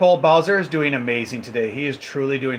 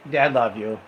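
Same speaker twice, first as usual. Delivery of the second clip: ordinary talk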